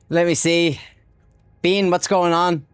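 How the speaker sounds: lightly put-on Cockney accent. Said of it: "You like my Cockney accent?".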